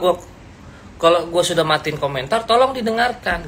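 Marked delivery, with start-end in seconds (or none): none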